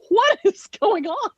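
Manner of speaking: high-pitched, incredulous demand; mock shocked outcry. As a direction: cry out in hammed-up bewilderment. Acts out shocked reaction in kitchen.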